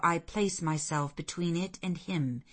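ordinary delivery

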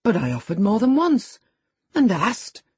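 stung, indignant self-defence; kindling up. A narrator's reading. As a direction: protest as your temper starts to rise. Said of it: "kindling up at her pertness".

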